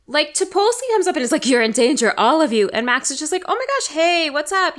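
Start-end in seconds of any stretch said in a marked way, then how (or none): 1.4-2.7 loud, urgent mock warning; mock screaming warning. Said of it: "starts screaming at me". 3.5-4.8 breezy mock greeting, higher at first; feigned nonchalance. Mocks Max's unbothered greeting.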